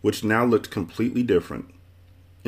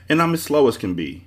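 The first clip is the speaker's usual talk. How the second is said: higher-pitched mild complaint; 7 semitones higher, 4 dB louder than usual. A narrator's reading. Man waving goodbye to a snail, complaining. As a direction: complain with the voice lifted. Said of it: "he complained".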